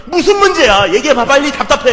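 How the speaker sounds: loud, high irritated demand; impatient shout at a late-night friend; impatient yell. Retold or imitated. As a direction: snap at a friend who won't talk.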